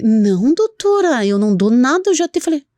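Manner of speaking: high-pitched mock protest; protesting pet owner. Owner denying treats to the vet, mock indignant. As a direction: imitate an owner insisting, pitch swooping up. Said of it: "Aí ele me assim".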